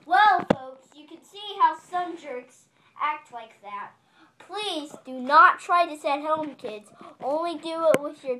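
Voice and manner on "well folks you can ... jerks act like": announcer voice. Acted. Kid hosting toy train video.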